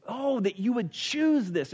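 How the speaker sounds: slow, high, drawn-out plea; emphatic, deep conviction. Retold or imitated. Moses urging Israel to choose, with deep conviction. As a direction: stretch it out with heartfelt urgency. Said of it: "very emphatic, very deep conviction".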